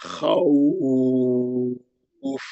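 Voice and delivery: "खौफ" held very long, rough throaty خ; rough kha. Shown as a demonstration. Teacher shows the rough throat sound of kha. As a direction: scrape the kha in "खौफ", stretch it. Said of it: "खुरदुरे आवाज से".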